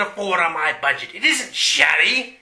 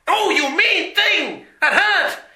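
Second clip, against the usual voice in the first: hurt whine; 9 semitones higher than usual. Acted. Villain whines after punch.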